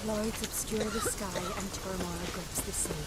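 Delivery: soft, solemn plea to a goddess; hushed prayer. Acted. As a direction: pray quietly for protection. Praying to a goddess amid storm.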